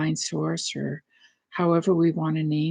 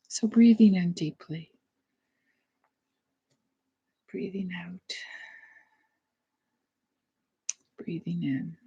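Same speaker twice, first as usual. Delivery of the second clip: soft, spacious breathing cues with long pauses; hushed meditation guide; guided meditation; 4 dB quieter than usual. Real, not acted. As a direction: lead the breath gently, letting the voice fade.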